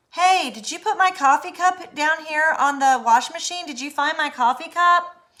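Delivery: high, loud call across the house. Retold or imitated. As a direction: shout the question to the other room. Calling out to partner.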